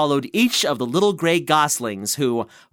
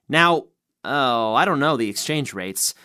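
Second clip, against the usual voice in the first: plain reading voice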